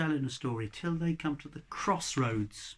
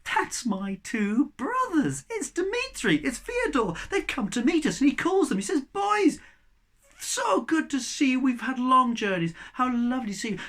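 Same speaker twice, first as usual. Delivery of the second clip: much higher, louder, gladly calling out; joyful greeting; 9 semitones higher, 7 dB louder than usual. Acted. Ivan calls out to his brothers, high and overjoyed. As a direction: hail your long-lost brothers with joy.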